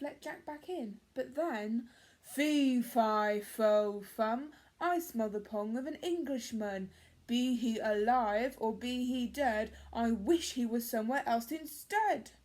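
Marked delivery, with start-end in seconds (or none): 2.2-12.3 loud, booming giant chant, hammered out; roaring giant. Acted. Giant smelling the boy again, loud roar. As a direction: bellow it to shake the castle. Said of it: "roared the giant".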